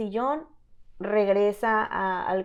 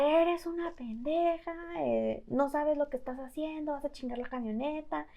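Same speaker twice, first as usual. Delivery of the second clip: retold yelling; 6 semitones higher, 5 dB quieter than usual. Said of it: "me empieza a gritar".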